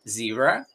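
"zebra" said the English way, ZEE-bra with buzzing z; English pronunciation. Shown as a demonstration. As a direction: say "zebra" in English, rising at the end. Inglés frente a español, zumbido. ("zebra" a la inglesa, con z sonora)

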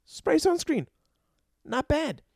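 imitating Larry's grudging approval; Larry considering. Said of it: "And he's like".

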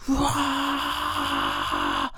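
long sustained high screeching roar; demonic roar. Retold or imitated. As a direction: let out a long hellish scream. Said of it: "it was like that, just this".

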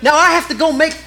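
mock exasperation. Real, not acted. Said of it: "How did I just lose".

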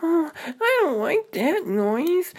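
put-on high, anxious child voice; scared little girl. Little sister alone in the dark, high and anxious. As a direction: fret like a frightened kid. Ruby hears another noise.

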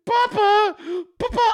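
high, loud put-on child voice; mock a little girl calling her father; Meghan McCain. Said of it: "I bet that's how she said it too".

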